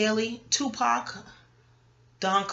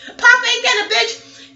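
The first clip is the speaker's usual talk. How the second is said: much higher and louder, slow; exclaiming in amusement; 13 semitones higher, 10 dB louder, slower than usual. Real, not acted. Joking about her party to viewers, loud and high. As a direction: throw it out in amused disbelief.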